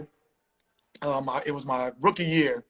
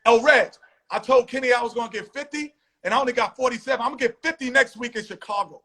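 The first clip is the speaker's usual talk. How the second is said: higher, louder acted-out trash talk; retold boastful challenge; 6 semitones higher, 4 dB louder than usual. Jordan to Kenny and Reggie after the game, imitated boasting. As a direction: call out the rival, imitating a cocky star.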